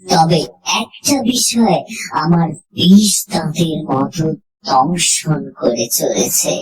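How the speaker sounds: ordinary delivery, nothing marked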